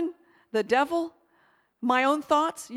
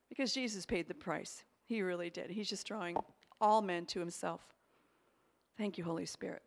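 soft reverent; 6 semitones lower, 8 dB quieter than usual. Real, not acted. Quiet worship after the testimony.

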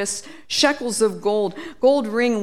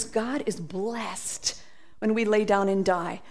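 ordinary talk

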